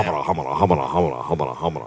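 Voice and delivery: low, soft rapid babble; comic patter. Pretend. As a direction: keep a bouncing rhythm under the others. Comic patter imitating riders in motion.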